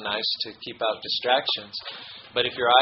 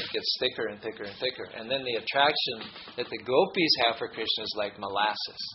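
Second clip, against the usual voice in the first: ordinary talk, nothing marked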